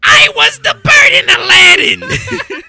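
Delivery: Gilbert Gottfried impression. Quoting Gilbert Gottfried from Family Guy, screeching. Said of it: "He's like".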